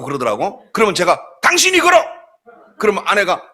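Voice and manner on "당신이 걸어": higher and louder, commanding; husband snapping back at wife, hammed-up bossy; mock bossy retort. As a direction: bark it back like the man of the house. Husband retorts to wife in retold quarrel.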